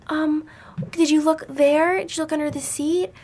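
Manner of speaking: high, sing-song mock innocence with rising questions; mock helpful girls. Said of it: "They were like".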